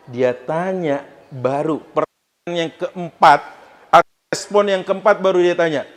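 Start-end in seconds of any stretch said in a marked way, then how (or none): none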